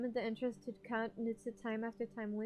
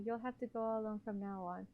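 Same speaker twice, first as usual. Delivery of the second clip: ordinary delivery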